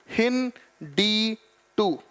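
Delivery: हिंदी टू drawn out slowly, हिंदी with its full final "ee", then टू; pronunciation demo. Shown as a demonstration. Teacher showing students how to say हिंदी टू, slow and careful. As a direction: spell out हिंदी टू slowly for the students, the "ee" of हिंदी clearly sounded. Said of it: "ऐसे इसका pronunciation है".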